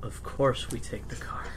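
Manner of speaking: soft conspiratorial whisper; whispered aside. Acted. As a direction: hush it under your breath. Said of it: "Linda says that".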